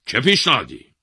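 loud, high sharp question; demanding king. A narrator's reading. King asking for the proposal, demanding. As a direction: demand it loudly.

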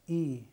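ای said as a long ee vowel; kasra sound i. Shown as a demonstration. Teacher showing the kasra vowel on alef. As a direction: say ای as a clear long ee.

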